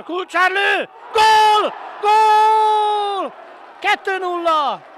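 screaming goal call, high and loud; goal shout. Real, not acted. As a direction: roar it out in triumph.

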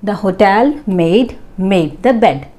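very slow, clear example read. Shown as a demonstration. Teacher reading the next example slowly. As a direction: read each word apart for learners. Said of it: "Next one again".

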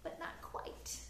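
soft unvoiced whisper. Shown as a demonstration. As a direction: breathe it, no voice. Whispered disappointment.

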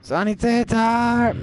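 Sanitäter drawn out slowly, mock German call. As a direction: stretch out Sanitäter like the soldiers. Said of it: "Sanitäter! (game NPC call)".

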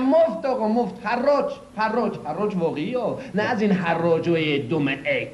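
put-on hawking free in his sweet regional Persian accent. Dealer insisting the car is free, in a regional Persian accent. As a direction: shout out the sale in your regional Persian accent. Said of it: "به لهجهٔ شیرین خودم".

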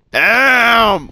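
high, hammed-up cry; sudden put-on high yell; mock outburst. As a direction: shout it as one long wail. Acting out husband's outburst.

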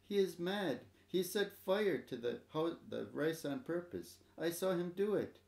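put-on mild tearful child telling on grandfather; lightly voiced scared little boy; sobbed. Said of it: "sobbed Tada".

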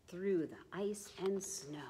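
hushed, unvoiced bedtime whisper; sleepy whisper. A narrator's reading. Reading penguins to sleep, soft whisper. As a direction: breathe the words like a lullaby.

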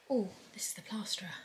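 hushed whisper. Real, not acted. Vlogger introducing the plasterer to viewers, whispering. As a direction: whisper so the plasterer won't hear. Plasterer arrives, filming quietly.

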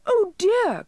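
very high, loud elf dismay; high little elf, aghast; dismayed cry. A narrator's reading. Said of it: "cried the elf in dismay".

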